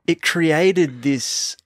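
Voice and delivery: usual speaking voice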